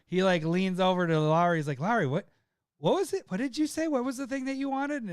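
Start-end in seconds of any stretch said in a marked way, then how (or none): 1.8-5.0 high, wheedling questions to a friend; sly coaxing. Retold or imitated. Rival player leaning in to coax the friend, high wheedling.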